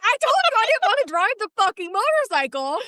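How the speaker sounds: high-pitched retold outburst; mock indignant protest. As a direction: act out yelling back in an argument. Retold argument, yelling back.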